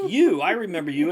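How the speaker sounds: loud, menacing robot voice; be the robot that remembers him; robot Roomba voice. Retold or imitated. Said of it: "the Roomba's like".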